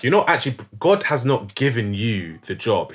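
ordinary delivery

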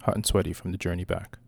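ordinary delivery

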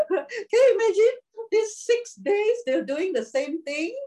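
high-pitched, talking through real laughter; amused, high, laughing voice. Real, not acted. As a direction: keep talking while still cracking up. Still laughing after the teasing.

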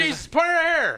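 high, loud exasperated yell. Retold or imitated. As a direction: bark the order, fed up. Voicing exasperated father.

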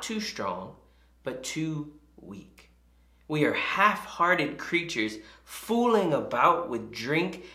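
slow, deliberate recitation with weighty pauses; measured literary reading, dropping off at phrase ends; dramatic quote reading. Acted. Preacher reciting C S Lewis to the congregation, slow and weighty. Reads out a famous author's passage.

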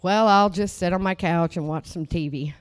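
mocking lazy person. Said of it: "People don't want to hear that".

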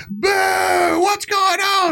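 very high imitated heckle; heckling crowd. Crowd as cymbals get unscrewed, mock high-pitched heckling. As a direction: mimic disgruntled festival-goers calling out. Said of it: "you can hear people in the crowd going".

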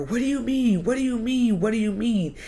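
high, pleading, repeated in rising panic; panicked disbelief. Retold or imitated. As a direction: relive the shock of hearing the news. Panicked retold reply to mom.

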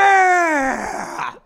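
big joyful yell; excited shout. Real, not acted. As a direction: shout it out in triumph. Celebrating a race win.

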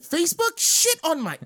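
high-pitched outraged exclamation; indignant disbelief. Real, not acted. Losing player hearing the answer was Facebook, indignant disbelief. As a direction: cry out in comic outrage.

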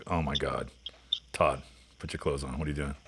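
hushed mock scold. Friends on the beach telling Todd to dress, hushed mock scold. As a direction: play it like you're shocked but keeping it down.

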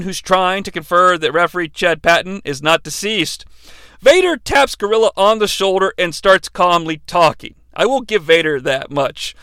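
ordinary delivery

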